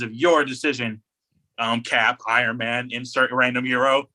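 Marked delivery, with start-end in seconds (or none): none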